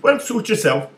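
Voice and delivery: high, loud breezy shrug; tiny voice, unbothered; leprechaun voice. Acted. Leprechaun shrugs off the big guy, high and breezy.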